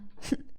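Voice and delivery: breathy disdainful little laugh; contemptuous snicker; scornful chuckle. Acted. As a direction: chuckle down at her. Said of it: "elle gloussa".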